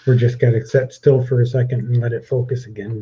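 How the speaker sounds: ordinary delivery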